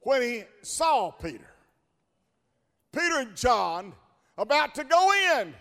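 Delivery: usual speaking voice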